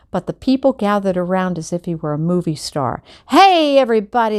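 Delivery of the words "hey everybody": loud, higher hail to the crowd; booming holler. A narrator's reading. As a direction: call out big to the whole crowd. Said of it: "hollered in a deep, booming voice".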